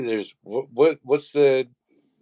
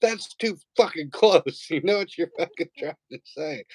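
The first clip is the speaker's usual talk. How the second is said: scolding wife; 9 semitones higher than usual. Retold or imitated. Wife telling off her son, mock scolding. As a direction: imitate a mom who isn't buying it.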